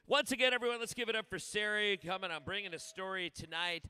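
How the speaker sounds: big applause call. Real, not acted. Host calling for applause again.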